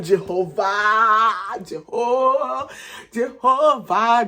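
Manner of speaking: long, loud drawn-out invocation; dramatic wail. Retold or imitated. Calling on God after hearing of cheating, wailing. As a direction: wail the name slowly, stretching each vowel. Acted cry to God in shock.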